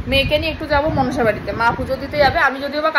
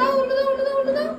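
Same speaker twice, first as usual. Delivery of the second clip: high coaxing call; 12 semitones higher than usual. Real, not acted. Coaxing toddler to ululate.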